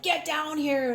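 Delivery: high-pitched, insistent mock demand; retold anger. Woman retelling how she challenged God, upset demand. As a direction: re-enact an upset order to come down. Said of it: "I was so angry".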